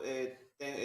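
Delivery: ordinary talk, nothing marked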